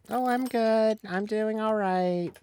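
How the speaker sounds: high, drawn-out polite answer; reply in a high sing-song, acting it out; imitated small-talk sweetness; sweet retold reply. Retold own reply to greeting.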